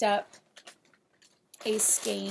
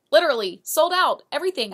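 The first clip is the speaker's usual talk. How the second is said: laughing and loud, faster; thrilled; 6 semitones higher, 13 dB louder, faster than usual. Real, not acted. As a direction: burst out delighted over the sell-out. Thrilled about selling out.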